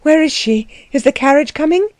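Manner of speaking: a touch of impatience; anxious questioning. A narrator's reading. Anna asking Letty about the carriage, anxious questioning.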